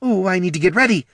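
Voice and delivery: high, loud, hurried fluster; frantic queen scrambling to get ready; flustered panic. A narrator's reading. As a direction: squeal it out in a rush. Queen panicking, visitor at door.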